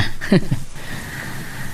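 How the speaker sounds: a breathy little laugh while teasing; soft laugh. Acted. Teasing Telma during their chat, soft laugh. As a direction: chuckle softly at her.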